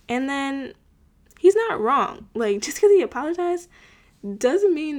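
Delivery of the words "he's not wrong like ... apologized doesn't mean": higher and louder, insisting he's right; emphatic. Real, not acted. Vlogger insisting Bodhi had a point, higher and louder. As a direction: push the point hard. Defending Bodhi in argument recap.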